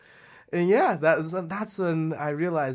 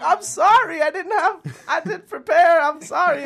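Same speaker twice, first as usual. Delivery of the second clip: put-on much higher, louder, giggling child saying sorry; laughing kid apology; 8 dB louder than usual. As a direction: beg sorry like a flustered kid through laughter. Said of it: "And I'm like".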